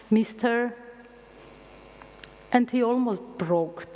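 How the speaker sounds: normal talking voice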